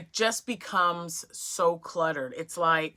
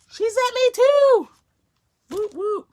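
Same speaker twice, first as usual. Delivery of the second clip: much higher and louder, delighted; thrilled squeal; 11 dB louder than usual. Real, not acted. Thanking her friend for sending two skeins, squealing with joy.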